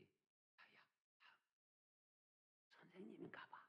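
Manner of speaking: very soft, barely audible aside; hushed whispering. Retold or imitated. Schoolgirls wonder about the watching adult among themselves, hushed whisper.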